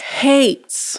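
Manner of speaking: drawn-out, emphatic "hates"; dramatic stress. Real, not acted. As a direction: land hard on "hates" after a suspenseful pause. Repeats the dramatic reveal.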